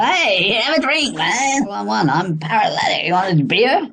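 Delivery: mocking a drunk YouTuber inviting viewers to drink. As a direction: ham up a drunk offering a beer. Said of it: "He gets absolutely paralytic".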